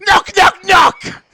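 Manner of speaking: very high, very loud shriek of knocks; shouted sound effect. Acted. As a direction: shriek it like a sudden jump scare. Acting the midnight knock.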